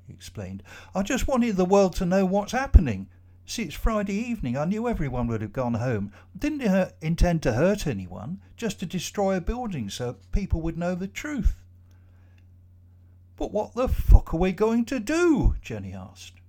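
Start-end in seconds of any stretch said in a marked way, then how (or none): 1.0-11.6 higher, earnest foreign man's voice, pressing on; Jacob pleading his cause. Acted. Jacob explains the bomb to the trapped office workers, higher and urgent. 13.4-15.6 much higher and louder, frightened outburst; panicked cry. Acted. Jenny cries out to the others, trapped in the dark lift, panicked and loud.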